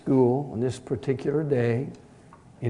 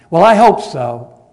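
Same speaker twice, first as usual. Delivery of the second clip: loud, higher sarcastic snap; surly snap; 5 semitones higher, 14 dB louder than usual. Retold or imitated. Impatient teen snaps at the church secretary, loud. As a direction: throw it back at her, fed up. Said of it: "surly attitude".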